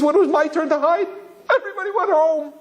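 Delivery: wailed in a very high mock child's voice; hammed-up heartbroken little boy; crying little boy. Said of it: "what the boy was crying about".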